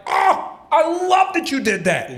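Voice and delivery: high, drawn-out gushing; gushing fan. Retold or imitated. Fan gushes to the comedian who roasted them, high and gushing. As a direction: put on a high voice and gush. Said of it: "motherfuckers be like".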